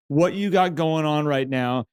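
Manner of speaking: ordinary delivery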